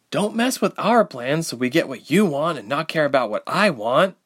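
high-pitched hurt retort, a touch hoarse. A narrator's reading. Ilse answering Reshma's bellow, mildly hoarse. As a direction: answer back through a tight, hoarse throat. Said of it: "Ilse said hoarsely".

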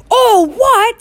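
high, thunderous outraged echo; thundering. A narrator's reading. Hagrid outraged at Harry's question, thundering. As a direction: throw the question back as a roar. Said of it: "Hagrid thundered".

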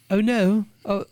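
high, mock medieval English voice; Game of Thrones English accent. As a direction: warn of danger in a fantasy English accent. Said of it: "Game of Thrones type".

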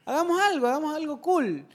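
lightly voiced eager proposal; excited invitation. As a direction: pitch it up like a giddy suggestion. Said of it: "fue un".

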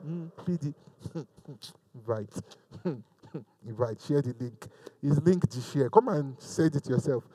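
very low, hushed mock mumble; reluctant, secretive invite. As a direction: imitate someone inviting so quietly that nobody hears. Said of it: "That's literally the attitude".

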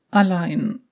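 high, sad echo of the word Allein; tearful girl voice. Acted. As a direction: repeat Allein close to tears.

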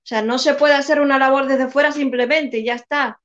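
ordinary talk

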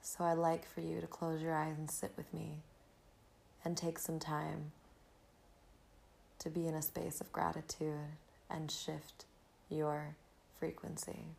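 breathy calm, guiding the listener inward; soft spa whisper. Acted. Leads a gratitude meditation.

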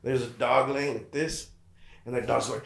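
ordinary talk, nothing marked

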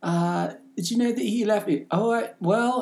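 high, badly faked accent; Grace Fraser. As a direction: imitate a mother's accent, pitched high. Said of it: "Bad accent".

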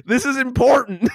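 laughing mock scold. Real, not acted.

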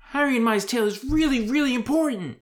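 put-on high, loud, insistent boy; Ron Weasley. Boy pressing the council to hear his tale, insistent. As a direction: push it high and urgent.

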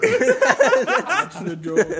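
very high, drawn-out laughing cry; laughing catchphrase. Acted. Echoing a friend's joke to the group, high laughing catchphrase. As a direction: draw it out high and loud through laughter.

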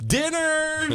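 high, loud exclaimed "Dinner!"; playful shout about the crab; gleeful mock shout. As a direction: announce it like a delighted cook.

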